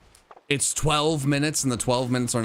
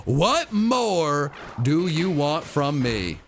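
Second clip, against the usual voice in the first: at wits end; 2 semitones higher, much slower than usual. Acted. Exasperated plea to the game.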